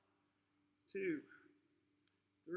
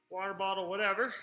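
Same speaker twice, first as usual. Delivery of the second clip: ordinary talk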